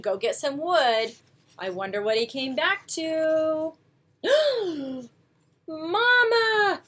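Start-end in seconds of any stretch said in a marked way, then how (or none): 4.2-5.0 high sharp surprised gasp. Acted. Goslings hatched surprise. 5.7-6.8 high little gosling cry; gosling calling mama. Acted. Newborn gosling calls bear.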